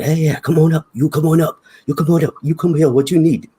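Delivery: high, loud mock greeter voice; imitating Scott. Imitating the sushi chef calling him past the line, high and loud. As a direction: play the eager sushi guy waving you up front. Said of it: "he's like".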